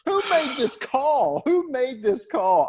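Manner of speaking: high, slow mock outrage; incredulous demand. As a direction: plead in disbelief, drawn out and high. Retelling own disbelief at casting choice.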